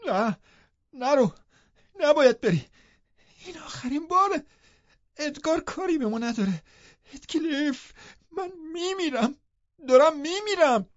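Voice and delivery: very high, desperate wailing shriek; frantic high-pitched cries; screaming. A narrator's reading. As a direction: shriek as if dying of despair. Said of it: "جیغ کشید".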